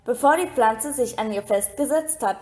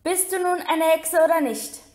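exasperated yell; 6 semitones higher than usual. Acted. Ron yelling at Hermine.

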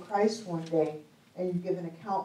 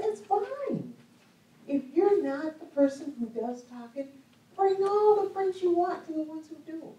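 high, loud, rallying cheer; excited encouragement; 9 semitones higher, 7 dB louder than usual. Retold or imitated. Preacher urging shy people to team up with talkers, excited and high. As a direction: pitch it way up and cheer them on. Acting out her encouraging realisation.

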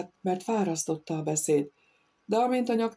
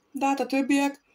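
kiáltva; 9 semitones higher than usual. A narrator's reading. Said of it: "kiáltotta a nyaktekergető".

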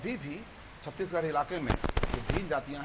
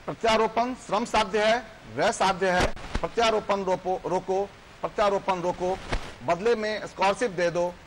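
loud, high, ringing verse recitation; declaimed poem; 5 semitones higher, 8 dB louder than usual. Acted. Guest recites his poem's rising public uproar to viewers, loud declamation. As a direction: declaim it forcefully, with pauses between phrases.

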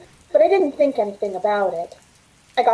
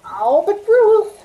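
higher, louder, slow whine; whining protest; 9 semitones higher, 5 dB louder, slower than usual. Acted. Millie whines back at Ruth, higher and drawn out. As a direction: drag out the objection.

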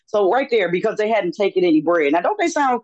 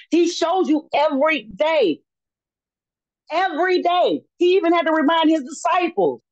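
higher-pitched, emphatic sermon delivery; fervent preaching; 6 semitones higher than usual. Real, not acted. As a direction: urge the congregation to believe. Preaches at rising force.

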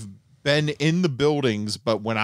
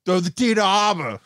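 put-on higher-pitched Boston accent; 7 semitones higher than usual. Cracking up the co-host, raised Boston accent. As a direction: call it out like a Bostonian. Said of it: "so good at a Boston accent".

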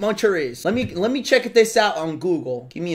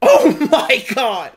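loud, high, drawn-out exclamation with laughter; hysterical disbelief; 5 semitones higher, 6 dB louder, slower than usual. Real, not acted. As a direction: stretch it out, cracking up. Cracking up at player choice.

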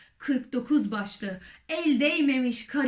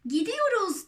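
excited shout; 8 semitones higher than usual. A narrator's reading. Someone announcing that they are off, excited shout. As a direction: call it out high, falling at the end. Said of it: "diye bağırdı biri".

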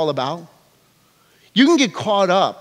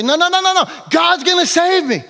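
high, insistent no-no-no; stubborn refusal; 10 semitones higher than usual. Acted. Man in the boat refuses the rescue boat, high and insistent. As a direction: wave them off, sure God will come.

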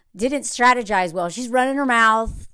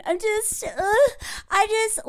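high breathy-free mock gush, much higher; mock Laura voice; 10 semitones higher than usual. Mocking Laura in the pods, high put-on voice. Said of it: "she was like".

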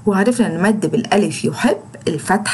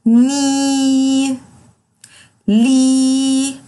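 ني and لي held long, higher and louder; long drawn vowels; 4 semitones higher, 3 dB louder, much slower than usual. Shown as a demonstration. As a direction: drill the long ee. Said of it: "وبطول في صوته".

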